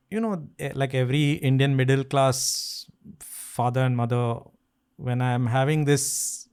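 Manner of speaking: normal talking voice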